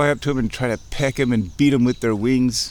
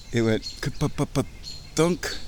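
imitated thunk; 3 semitones higher, 4 dB quieter, much slower than usual. Imitating ball landing.

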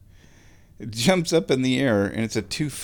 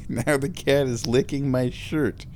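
usual speaking voice

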